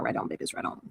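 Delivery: soft whispered goodbye; whispered sign-off. Real, not acted. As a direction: breathe the sign-off out. Signing off the stream.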